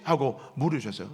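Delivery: ordinary talk, nothing marked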